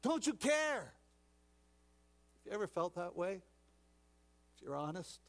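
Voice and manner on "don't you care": loud, higher angry yell; shouting. Acted. Disciples shouting at sleeping Jesus in the storm, loud and high. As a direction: shout at him to wake. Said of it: "began to shout at Jesus".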